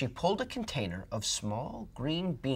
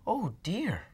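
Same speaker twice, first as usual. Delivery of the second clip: put-on sad old woman; 8 semitones higher than usual. A narrator's reading. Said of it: "the old woman said sadly".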